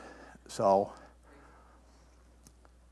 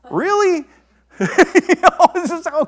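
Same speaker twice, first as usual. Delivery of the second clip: high, louder disbelieving "Really?"; can you believe it, pitched way up; incredulous; 12 semitones higher, 8 dB louder than usual. Real, not acted. Preacher scoffing at Aaron's feast, high and loud in disbelief.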